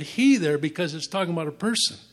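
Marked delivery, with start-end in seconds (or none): 0.0-0.4 he punched high and loud; stress on he. Shown as a demonstration. Preacher singling out "he" for the congregation, high and punched. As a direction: lean hard on the word "he" so it stands out. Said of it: "I emphasize the word".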